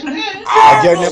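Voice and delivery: high, loud mock panic; shrieking I don't know in fake studio panic; hammed-up yell. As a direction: yell it over the chaos like you've lost control. Chaos in the studio, yelling.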